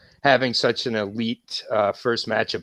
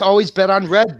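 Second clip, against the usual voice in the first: Wesley Snipes quote; 7 semitones higher, 5 dB louder than usual. Retold or imitated. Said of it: "the Wesley Snipes phrase".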